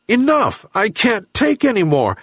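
high-pitched angry outburst; raging. A narrator's reading. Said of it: "he raged".

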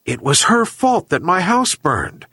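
high-pitched aggrieved complaint; complaining. A narrator's reading. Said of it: "complained the old man".

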